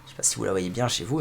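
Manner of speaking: ordinary talk, nothing marked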